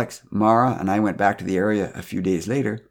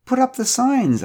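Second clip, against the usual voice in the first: high, slow, curious question; 5 dB louder, slower than usual. A narrator's reading. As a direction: ask with innocent curiosity. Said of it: "I asked curiously".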